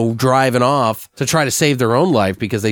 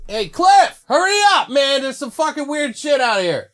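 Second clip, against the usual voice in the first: calling out. Acted. Eric shouting to Cliff to hurry because something weird is outside. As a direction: yell for your partner to hurry.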